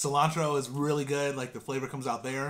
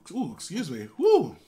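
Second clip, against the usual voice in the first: hiccup mid-phrase; a sudden hiccup; 2 semitones higher, 6 dB louder, slower than usual. Real, not acted. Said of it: "What was that?".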